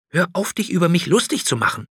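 put-on fast, high, cross boy's complaint; scolding. A narrator's reading. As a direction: snap at the grinning dragon. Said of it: "schimpfte Niels". (schimpfend, schnell und hoch)

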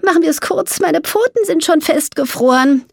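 high-pitched, weary complaint; impatient mole. A narrator's reading. Mole with frozen paws wants it short, impatient. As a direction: hurry it along in a high mole voice.

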